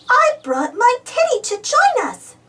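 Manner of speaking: put-on high little girl piglet voice; chirpy high child voice; Peppa voice. Little Peppa telling Susie about her teddy, high child voice.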